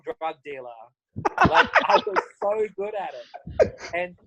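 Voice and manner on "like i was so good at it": high and slow, laughing through it; chuckling boast. Real, not acted.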